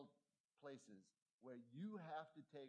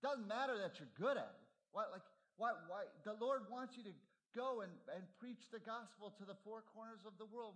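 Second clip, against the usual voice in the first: higher, louder exasperated scolding; scolding sister; 7 semitones higher, 7 dB louder than usual. Retold or imitated. Sister scolding Eric about wasting time on athletics, loud and exasperated. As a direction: nag him like a missionary sister fed up. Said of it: "And she said".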